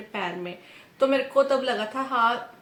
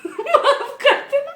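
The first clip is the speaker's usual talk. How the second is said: high giggling sorry, words stretched out; laughing apology; 8 dB louder, much slower than usual. Real, not acted. Apologizing to God for joking, giggling high. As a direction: laugh helplessly while begging forgiveness.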